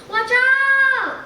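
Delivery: sudden high, loud shout of alarm; urgent cry of watch out; yelled warning. Acted. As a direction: shout it to save your friend. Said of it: "he yelled to Tom".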